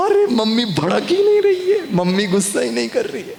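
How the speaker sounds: high, laughing exclamation; amused laugh. Real, not acted. Speaker laughing at the mother who won't get angry, high laughing exclaim.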